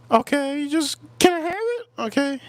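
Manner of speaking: sweet, high and teasing; mock girly voice. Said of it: "They go".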